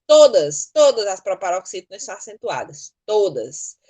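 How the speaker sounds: "todas" hit hard each time it comes; emphatic stress. Real, not acted. Teacher insisting every such word takes an accent, "todas" stressed. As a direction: punch "todas" as an absolute rule. Teacher hammering an absolute rule.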